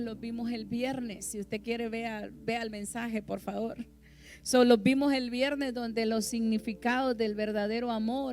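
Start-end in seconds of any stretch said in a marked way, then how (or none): 4.4-8.3 loud, building preaching; fiery preaching. Acted. Preacher building to a loud climax on love. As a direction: preach love's traits with rising force.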